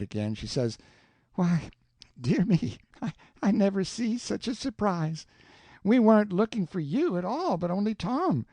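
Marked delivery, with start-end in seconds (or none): 1.3-8.4 higher, flustered happy aunt; Aunt Sally greeting Sid, surprised; delighted surprise. A narrator's reading. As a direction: gasp it out in glad surprise.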